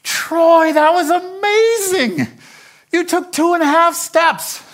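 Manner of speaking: put-on very high, loud cheer for a toddler; over-the-moon proud dad shouting high; thrilled father. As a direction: squeal with delighted praise, drawn out. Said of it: "No, he says".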